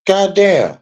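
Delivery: ordinary talk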